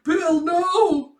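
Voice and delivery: high, loud drawn-out wail; anguished cry. Acted. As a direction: cry out for a falling friend. Friends cry out for Bill.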